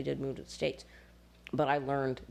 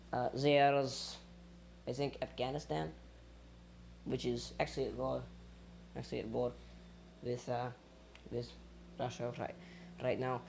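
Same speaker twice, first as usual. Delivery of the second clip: Russian-accented English, trailing softer; 2 semitones lower, 5 dB quieter than usual. Shown as a demonstration. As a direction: keep up the Russian accent, quieter. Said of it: "I do the Russian accent when".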